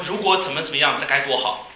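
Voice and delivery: usual speaking voice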